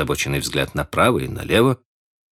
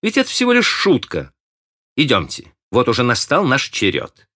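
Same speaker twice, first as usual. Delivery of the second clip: higher, friendly and light; 8 semitones higher than usual. A narrator's reading. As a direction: coax him kindly, it was only a joke. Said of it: "сказала дружеским тоном".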